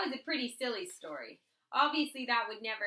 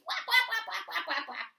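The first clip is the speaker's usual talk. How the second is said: flapping sound effect; 6 semitones higher, 4 dB louder than usual. A narrator's reading. Baby flapping daddy's face.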